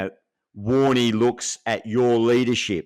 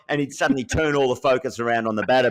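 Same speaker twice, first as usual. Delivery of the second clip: normal talking voice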